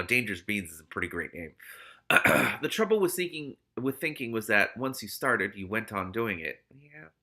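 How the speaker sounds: ordinary delivery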